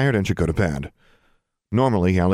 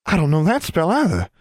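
put-on higher, excited young girl; exclaiming; 10 semitones higher than usual. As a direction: blurt it out in surprise. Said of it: "she exclaimed".

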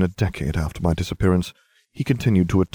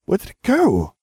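put-on a much higher, bewildered girl; Hermione's voice; 13 semitones higher, 3 dB louder than usual. A narrator's reading. Hermione asking where the troll went, high and puzzled. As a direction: ask in amazement.

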